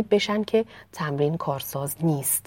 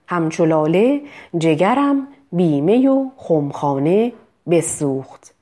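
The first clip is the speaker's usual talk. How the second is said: slow recitation of the Hafez line; slow verse recital; 5 dB louder, slower than usual. Shown as a demonstration. Teacher reciting a poem line to practise چ. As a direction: recite the verse slowly, savouring it.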